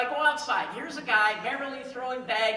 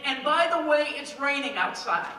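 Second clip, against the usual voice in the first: usual speaking voice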